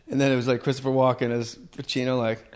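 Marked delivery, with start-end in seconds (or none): none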